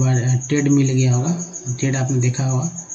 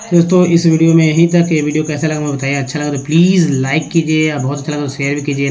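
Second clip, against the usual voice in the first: quick upbeat outro, louder; video outro; 5 dB louder, faster than usual. Acted. Host wraps up his lesson video upbeat. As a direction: rush the like-and-share ask.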